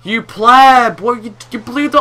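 loud, high outraged shout; angry yelling. Real, not acted. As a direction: yell at your friend in disbelief. Friend blew the door up.

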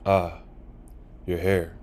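plain reading voice

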